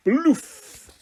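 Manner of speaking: sharp high "bloof" sound; cartoon uninvent pop; loud high magic pop. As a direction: burst it out like a magic poof.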